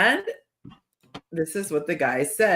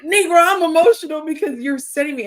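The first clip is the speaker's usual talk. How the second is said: much higher, louder exasperated snap; retold exasperation; 10 semitones higher, 6 dB louder than usual. Woman snapping back at gaslighting ex, exasperated. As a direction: fire it back, fed up. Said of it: "And I'd be like".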